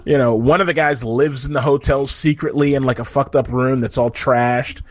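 ordinary talk

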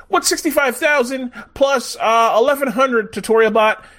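a hint of a child quizzing a bot; little kid. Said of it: "The little kid is asking him".

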